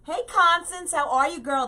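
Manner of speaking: high, loud, gushing hello; light up as if spotting a friend; excited greeting. Real, not acted. Greeting a viewer named Constance in the chat, high and loud with delight.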